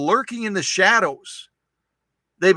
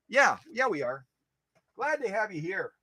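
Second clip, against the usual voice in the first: usual speaking voice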